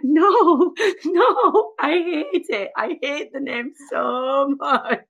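high, loud mock wail; hammed-up high-pitched objection, slowing at the end; retold protest. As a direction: wail it in comic despair, half laughing. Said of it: "And I'm like".

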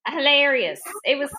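ordinary delivery

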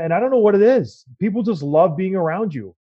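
normal talking voice

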